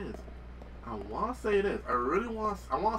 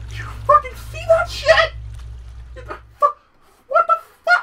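high, louder stunned cry; shocked disbelief; 9 dB louder than usual. Real, not acted. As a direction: gasp out the shock. After the jump scare.

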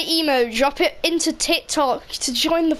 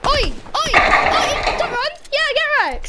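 much higher gloating cry; triumphant taunt; 11 semitones higher than usual. Real, not acted. Gamer gloating at an enemy running away.

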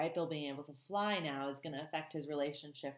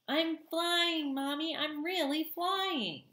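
very high, thrilled little voice; excited gosling; 11 semitones higher, 3 dB louder, slower than usual. A narrator's reading. Gosling tells his mommy he is flying, high and thrilled. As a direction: beam with joy, stretching the last words slowly.

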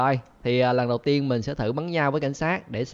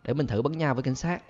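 usual speaking voice

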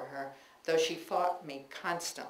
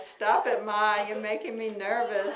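mild yell back; 4 semitones higher, 6 dB louder than usual. Retold or imitated. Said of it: "I yelled back".

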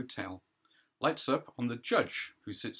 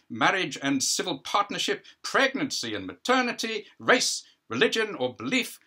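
louder, formal courtroom reading; prosecuting counsel; 4 semitones higher, 6 dB louder than usual. Acted. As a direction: lay out the charges like a barrister on his feet. Counsel's opening statement.

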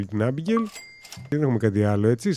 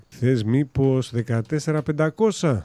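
slow coaxing offer; slower than usual. Acted. Haggling over house price.

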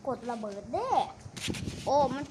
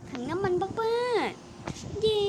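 ถังน้ำมันบ้าๆ stretched out slow and high; mocking drawl; 7 semitones higher, much slower than usual. Mocking the fuel can, slow high drawn-out ถังน้ำมันบ้าๆ. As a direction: drag out ถังน้ำมันบ้าๆ in a sing-song, slow and high. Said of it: "บ้าๆนี่กลับไปดิ".

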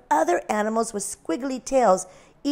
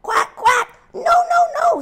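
very high, loud squeaky duck; duck quacking; 8 dB louder than usual. A narrator's reading. Mommy Duck quacking no at the lamb. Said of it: "said Mommy Duck".

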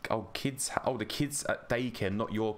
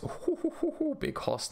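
much higher, delighted hoots; excited hooting. Real, not acted. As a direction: whoop it up over the big find. Excited at big loot drop.

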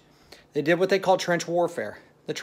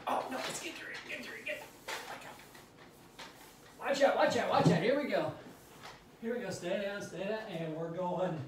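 panicked under fire; 4 semitones higher, 13 dB quieter than usual. Pretend. Crouched soldier role-play under shelling.

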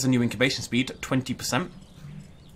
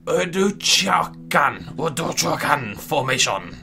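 slow, drawn-out mock Russian accent on Urduchakan; 4 dB louder, much slower than usual. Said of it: "That's my Russian accent".

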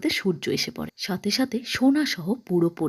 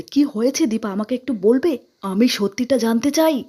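higher, louder desperate demand; husband begging his wife for the truth, raised voice; pleading shout; 4 semitones higher, 5 dB louder than usual. A narrator's reading. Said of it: "দীপাকে চিৎকার করে বলছে".

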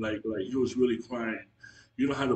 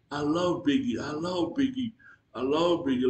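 higher, louder, a mild imitated tearful plea; 4 semitones higher, 3 dB louder than usual. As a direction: mimic a man wailing the same words over and over. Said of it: "Like, he kept crying".

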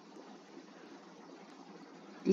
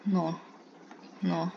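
ordinary talk, nothing marked

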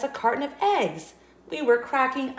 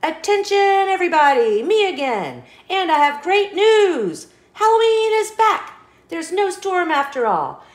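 loud, higher, cheering announcement; excited announcement; 8 semitones higher, 3 dB louder than usual. Acted. Seed tells the field Halloween is back, loud cheering announcement. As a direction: call it out joyfully to everyone.

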